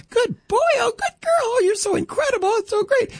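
very high, cooing praise; gushing baby talk. Retold or imitated. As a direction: gush over the dog in a sing-song coo. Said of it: "it sounds like".

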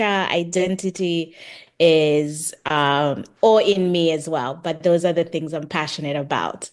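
normal talking voice

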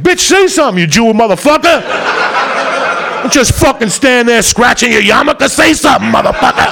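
high, loud panicked yelling at the lawyer; frantic plea. Retold or imitated. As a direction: shout at your useless attorney. Yelling at his attorney in court.

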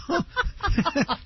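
high-pitched burst of laughter. Real, not acted. Laugh after quote.

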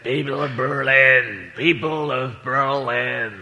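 slow, low-pitched speech to a crowd, drawn out; a poor presidential impression, very slowly declaimed; President Kennedy. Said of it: "can't do President Kennedy as an impression".

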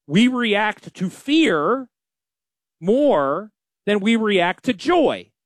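slow, deliberate and weighty; slow, stressed delivery of a lesson; slow emphasis. Real, not acted. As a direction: lay each word down slowly.

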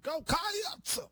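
"Go Cats" with Cats pulled into two syllables, high and shouted; overdone Kentucky accent. Retold or imitated. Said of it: "You're adding a syllable".

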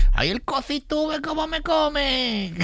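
very high, long drawn-out cry; mock fan yell. Comedian mimicking gitano fans who follow him, high mock yell. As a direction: imitate fans yelling, vowels stretched high. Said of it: "Y me gritan".

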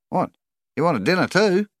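high, loud sneer. A narrator's reading. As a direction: throw it at a stranger as a sneering question. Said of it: "sneered Colin".

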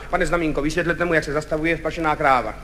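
low, quiet director; Kachyňa. Retold or imitated. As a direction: hand over the job low and softly. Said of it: "tak on se ještě zastavil a povídá". (hluboký, tichý hlas režiséra)

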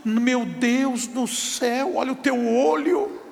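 high, put-on mocking voice with laughs; mocking husband. Husband mocking wife's new eyes. (marido zombando, voz aguda e risonha)

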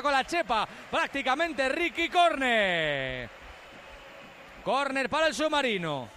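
brisk football commentary; live play-by-play. Acted. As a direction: call the match live.

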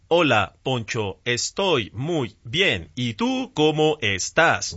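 slow, even chatbot voice; mock robot politely answering Poncho; old robotic assistant. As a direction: spell it out like a stiff old robot. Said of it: "como un robot".

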